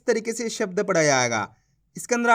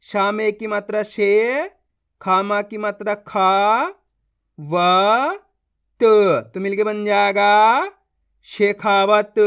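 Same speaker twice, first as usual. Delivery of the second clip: slow spelled-out reading; 4 dB louder, slower than usual. Shown as a demonstration. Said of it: "देखो किस तरीके से है।".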